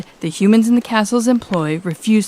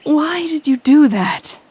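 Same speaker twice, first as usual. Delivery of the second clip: slow, higher, dumbfounded question; stunned disbelief; 8 semitones higher than usual. Acted. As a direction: ask it still reeling in shock. Stunned question after the stunt.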